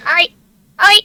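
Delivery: short high squeaks; high-pitched voice. Shown as a demonstration. Trying out the requested voice, high-pitched. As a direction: test a high pitch one syllable at a time. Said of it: "talking in the high-pitched voice".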